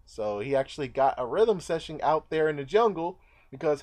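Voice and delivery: ordinary talk, nothing marked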